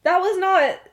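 high, loud retold protest; emphatic disagreement. James telling Remus the date went badly, emphatically. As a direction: act out an adamant contradiction, hitting each NOT. James disputes that they were pleased.

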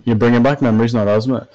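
ordinary delivery

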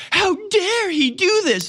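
high, slow, prissy mock outrage. Mocking Vineyard residents, slow, high mock outrage. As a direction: squeal it out like a scandalized snob. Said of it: "people are just like".

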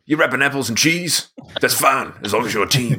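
lightly put-on tough-guy coach; hint of a motivational cartoon hero impression; Mister T. Mister T approving of the snack team, light impression. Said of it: "Mister T says".

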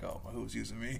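soft, fretful aside to himself; muted freaking out. Retold or imitated. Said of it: "I was just freaking out".

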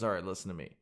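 low, hushed quoted voice. Retold or imitated. Host voices rapper announcing his plan, low and quiet. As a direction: drop low and quiet, as if confiding an ambition. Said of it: "is he goes".

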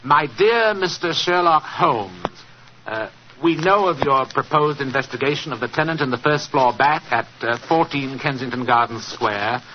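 slow, deliberate dictation; dictating slowly. Acted. Holmes dictating the letter to Gardner, slow and deliberate. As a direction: spell out each word for the scribe. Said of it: "I want you to write this letter".

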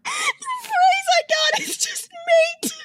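high squealing voice, nearly crying with laughter; cracking up. Real, not acted. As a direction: squeeze the words out through helpless laughter. Co-host cracking up while explaining.

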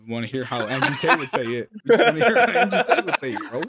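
high, loud, laughing insistence; laughing plea. Real, not acted. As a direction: egg a friend on while cracking up. Teasing friend into an impression, cracking up.

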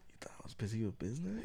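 quick, hushed comic whisper; whisper bit. Pretend. Echoing the co-host's excuse back, mock whisper. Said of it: "the whisper bit".

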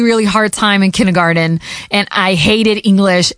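usual speaking voice

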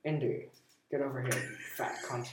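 softly voiced Scottish actor impression; a light, low-key Scottish impression; quiet mock Scottish film-star voice; Sean Connery. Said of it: "or my best Sean Connery".

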